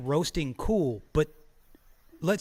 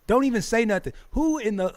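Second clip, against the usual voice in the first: louder, raised warning yell; mock angry shout; 5 semitones higher, 5 dB louder than usual. Streamer yelling at critics to shut up. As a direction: tell them to shut up, hard. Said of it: "I'm like".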